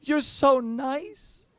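slow, high, overwhelmed gush of affection; gushing stewardess. Retold or imitated. Ticket lady overwhelmed at the preacher's presence, mock gushing. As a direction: imitate her gushing, high and drawn out. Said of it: "she says".

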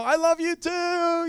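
high, drawn-out playful affection; called-back reply. Acted. Preacher calling back while walking away, playful gushing. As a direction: sing it back with mock adoration. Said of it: "And I'm like".

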